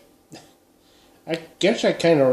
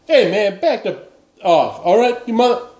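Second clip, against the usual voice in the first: higher, louder mock tough-guy warning; hammed-up threat; 6 semitones higher, 4 dB louder than usual. To pushy Minnesotans at the door, mock angry threat. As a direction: play a cornered guy waving a gun, half-joking. Pulling a gun on pushy neighbours.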